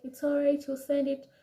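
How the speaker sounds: hint of a foreign English lady's accent; imitating a Western accent.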